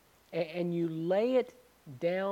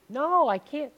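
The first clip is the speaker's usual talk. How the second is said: much higher and louder, frantic refusal; shrieking girlfriend; 9 semitones higher, 7 dB louder than usual. Retold or imitated. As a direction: yelp it out like the scared girl. Girl refusing.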